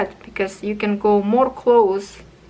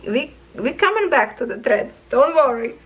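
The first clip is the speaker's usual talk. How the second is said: ordinary delivery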